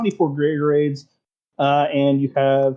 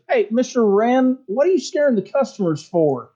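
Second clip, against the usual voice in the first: calling out; 8 semitones higher than usual. Acted. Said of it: "Jamathor says".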